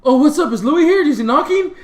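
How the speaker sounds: high, loud startled questions; startled awake. Retold or imitated. Drunk friend woken by the guys, startled. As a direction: imitate someone jolted out of sleep.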